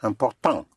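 French "important" with the final T left silent. Shown as a demonstration. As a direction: end "important" on a nasal vowel, no T sounded. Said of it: "You don't sound the T".

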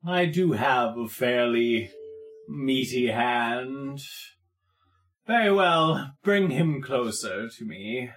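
lightly voiced lord, measured and deliberate; menacing Wallace. Wallace orders a captive brought closer, lightly voiced measured menace. Game master plays Wallace.

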